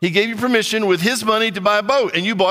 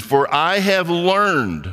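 slow, deliberate reading; 2 semitones lower, much slower than usual. Shown as a demonstration. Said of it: "preacher slows the key phrase".

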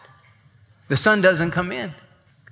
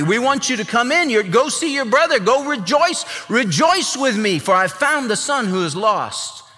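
pleading father; 7 semitones higher than usual. Acted. Father pleads with son to come.

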